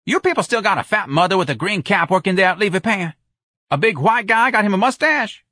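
high, loud, insistent caller; Jones. A narrator's reading. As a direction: holler the question down the phone. Jones calling over the telephone.

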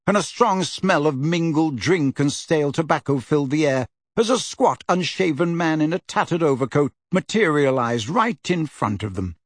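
ordinary delivery, nothing marked

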